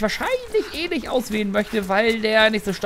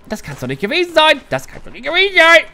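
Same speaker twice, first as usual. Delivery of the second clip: frustrated outburst; 5 semitones higher, 5 dB louder than usual. Real, not acted. Rising frustration, repeated thrice.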